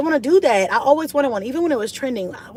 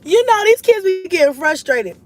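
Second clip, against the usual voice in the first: much higher and louder, amused; amused high exclaim; 10 semitones higher, 7 dB louder than usual. Real, not acted. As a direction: burst out in disbelief. Laughing about kids crying over games.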